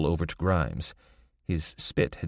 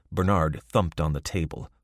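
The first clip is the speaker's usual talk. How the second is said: ordinary delivery, nothing marked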